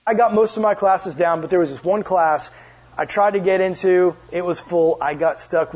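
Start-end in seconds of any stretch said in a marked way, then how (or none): none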